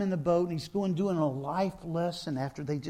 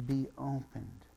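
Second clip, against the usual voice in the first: soft command; 5 semitones lower, 6 dB quieter than usual. Retold or imitated. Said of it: "he says in the Aramaic".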